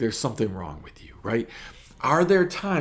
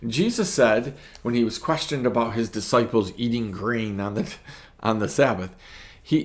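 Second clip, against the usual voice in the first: usual speaking voice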